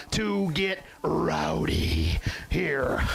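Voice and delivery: drawn-out, raspy pre-kickoff hype; slow, rough-voiced rallying call; rowdy hype. Real, not acted. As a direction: stretch it out slowly to rev up the crowd. Hyped-up kickoff cry.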